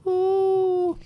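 high, whispery goblin wail; a lost soul wailing its tale; mild goblin wail, thin and sorrowful. Acted. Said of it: "wailed the goblin".

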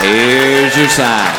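low, very slow deadpan; slow low deadpan tag line; catchphrase punchline. Acted. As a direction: stretch it out low and dry.

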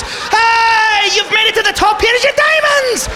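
very high, shouted leprechaun welcome; squeaky mock mascot announcing the prize; Lucky Charms guy. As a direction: cheer them on like a cartoon mascot. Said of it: "the Lucky Charms guy's waiting".

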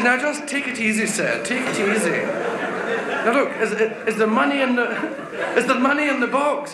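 mildly acted patient operator; telephone operator. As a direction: soothe a frantic caller, a touch high. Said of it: "She says".

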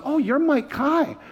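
put-on high, slow child voice; small boy reading a name tag, high; little boy. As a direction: sound like an awed six-year-old. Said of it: "he goes".

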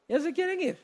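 small, high, soft voice; high teasing voice. Real, not acted. Preacher teasing restless congregation, high soft voice.

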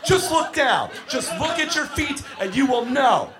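high-pitched, losing it; exasperated shout. Retold or imitated. Grown man to kids putting on skates, exasperated shout. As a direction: yell it as if at your wits' end. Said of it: "losing his shit, just going".

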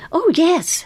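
high-pitched, eager yes. A narrator's reading. Girl answering the carnival boss, eager. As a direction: pipe up keenly on a high note. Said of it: "she said eagerly".

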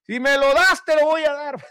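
high, put-on pleading voice; mock bargaining prayer. Preacher parodying a loud bargaining prayer, high put-on voice. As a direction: play a woman haggling with God. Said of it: "ella estaba gritando".

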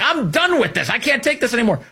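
high, whiny mock of a fed-up voter; mock exasperated voter. Host mocking overreacting voters, high exasperated whine. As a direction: parody someone throwing up their hands. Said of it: "that people are like".

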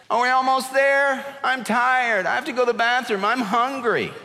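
put-on high, nagging kid's complaints; whiny child. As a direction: nag like a tired child on a long trip. Child whining in car.

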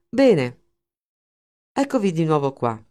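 sarcastic opening word, then a cold, biting welcome. Acted. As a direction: greet them with open sarcasm. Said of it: "La sua voce trasudava sarcasmo". (sarcastico, tagliente)

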